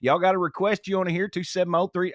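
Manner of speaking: country it up for the radio crowd. Shown as a demonstration. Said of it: "I'll kinda talk a little bit like this".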